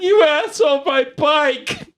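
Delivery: fake-blubber the insult like a wronged kid; mock crying. Crying game guy blames the player for his bike, mock sobbing. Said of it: "he starts crying".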